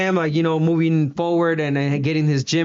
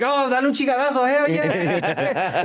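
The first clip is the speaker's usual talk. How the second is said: high, fast, rowdy Spanglish banter; high-pitched hyped-up homeboy; back-home hype; 9 semitones higher than usual. Acted. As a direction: mimic an excited fan talking back home. Mimics casual commentary from back home.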